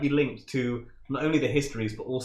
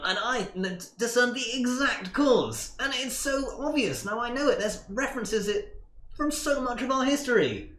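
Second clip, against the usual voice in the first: nerdy voice; 10 semitones higher than usual. Acted. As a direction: unveil the theory proudly. Milton reveals his theory.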